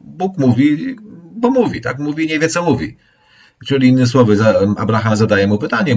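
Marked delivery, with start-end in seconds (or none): 0.0-3.0 high squeaky mock of a confused speaker. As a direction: mimic someone who doesn't know what he's saying. Mocking a God who rambles.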